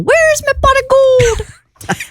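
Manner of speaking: high, slow, hammed-up Irish cry; Irish accent yell. Shown as a demonstration. Host showing the Irish yell to contestants. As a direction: yell it like a leprechaun. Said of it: "you must yell".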